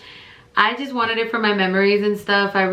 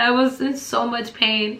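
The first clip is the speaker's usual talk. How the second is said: ordinary delivery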